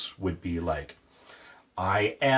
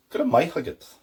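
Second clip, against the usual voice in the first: Good of my huggit in Ulster style, my for ma; Ulster Irish; 6 semitones higher, 3 dB louder, faster than usual. Shown as a demonstration. Teacher modelling the Ulster form. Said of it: "And then Ulster".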